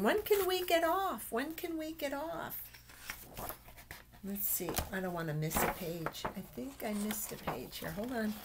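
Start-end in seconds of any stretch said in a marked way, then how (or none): 0.0-2.6 high, fading plea of bored animals; impatient animals. Acted. Animals on the ark pleading to get off, high and impatient. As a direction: whine it, asking to be let off. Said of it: "they're all looking, thinking".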